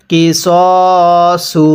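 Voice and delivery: কিসসু with the স vowel held long, very slow; drawn-out recitation. Shown as a demonstration. Teacher showing the one-alif stretch on সদ, slow chant. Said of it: "সদের মধ্যে আমরা এক আলিফ টান দিব".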